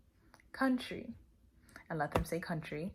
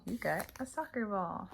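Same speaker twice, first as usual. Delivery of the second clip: super cute; 3 semitones higher than usual. Shown as a demonstration. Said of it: "you have to be super cute".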